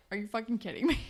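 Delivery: normal talking voice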